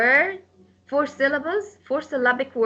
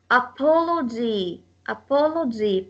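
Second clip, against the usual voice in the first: "Apology" with second syllable PO stressed. Shown as a demonstration. Teacher demonstrates the rule, PO stressed in "Apology". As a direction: lean on the PO in "Apology". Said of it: "po will take the stress".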